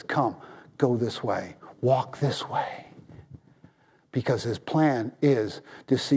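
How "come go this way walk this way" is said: soft, slow and quieter, calling the sheep along; lightly voiced shepherd, gently beckoning; gentle calling shepherd. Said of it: "the shepherd's voice that says".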